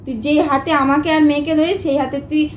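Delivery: ordinary delivery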